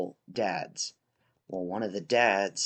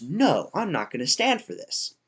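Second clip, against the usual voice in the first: higher, louder mock outrage; indignant refusal, retold; 7 semitones higher, 5 dB louder than usual. Dad refusing the restaurant host over masks, indignant. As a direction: act out an angry customer digging in.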